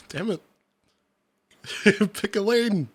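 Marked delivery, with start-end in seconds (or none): none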